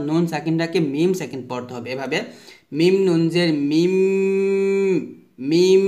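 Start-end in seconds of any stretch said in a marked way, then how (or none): 2.8-5.8 each final "মিম" held on a long nasal hum; ghunna demonstration. Shown as a demonstration. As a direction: model "মিম" with a stretched closing m. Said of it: "এভাবে পড়তে হবে". ("মিম" গুন্নাসহ লম্বা করে)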